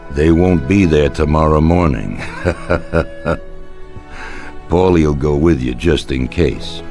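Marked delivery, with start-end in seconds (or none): 2.2-4.6 quiet, drawn-out chuckle. Acted. Boss relishing his plan, quiet chuckle. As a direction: chuckle softly to yourself.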